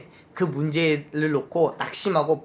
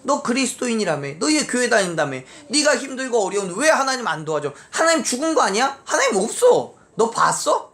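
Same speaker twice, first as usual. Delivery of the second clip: higher-pitched, jeering challenge; taunting questions climbing ever higher; mocking taunt; 6 semitones higher than usual. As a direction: needle them like a scoffing unbeliever. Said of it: "조롱해요".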